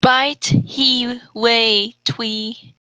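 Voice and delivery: usual speaking voice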